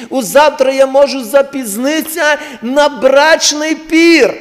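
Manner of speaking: loud, rising preacher's voice, pleading with God; fervent preaching. Acted. Preacher calling the church to repentance, loud and fervent. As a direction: preach it hard, pleading for mercy.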